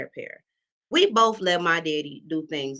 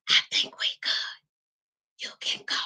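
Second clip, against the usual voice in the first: slow whispered brush-off; hushed dismissal; 4 dB quieter, slower than usual. Retold or imitated. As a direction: whisper her away, slowly. Dismissing the lady quietly.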